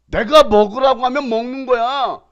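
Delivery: exasperated scolding; scolding God. Acted. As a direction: scold the stubborn one. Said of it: "라고 하나님 말씀하시는 거예요".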